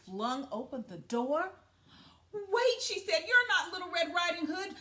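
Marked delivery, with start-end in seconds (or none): 2.3-4.8 high, loud startled cry; alarmed. Acted. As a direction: she suddenly realizes. Grandmother alarmed.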